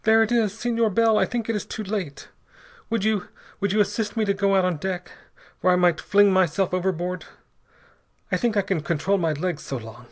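high-pitched, desperate pleading; unsteady. A narrator's reading. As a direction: beg while barely keeping control. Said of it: "said Ortiz unsteadily".

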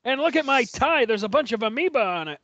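high, mocking character voice; hammed-up mock wrestler voice; Larry impression. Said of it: "here's Larry and his rug again".

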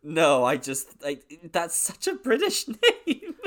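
loud, high-pitched, breaking into a laugh; laughing protest. Real, not acted. Co-host defending a joke about the British name, laughing. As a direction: protest through rising laughter.

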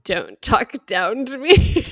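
slow, loud mock indignation; hammed-up offense, drawn out slowly; mock offended. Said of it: "And I'm like".

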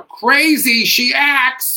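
high, drawn-out mock yell; comic outburst. Joking about loving their mom, hammed-up yell. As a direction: blurt it slowly, very high and loud.

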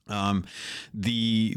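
normal talking voice

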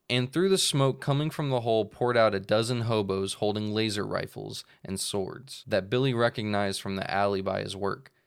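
ordinary talk, nothing marked

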